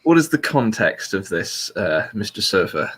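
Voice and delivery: ordinary talk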